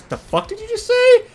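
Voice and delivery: outraged yell. Acted. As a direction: spit it out in shocked fury. Bliss spits out food, outraged.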